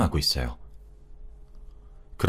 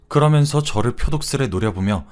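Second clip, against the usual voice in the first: ordinary delivery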